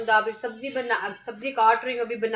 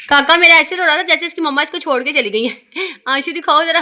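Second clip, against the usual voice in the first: much louder, higher playful teasing; teasing the little one; 4 semitones higher, 9 dB louder than usual. Real, not acted. Teasing the crying little son. (रोते बच्चे को चिढ़ाते हुए ज़ोर से)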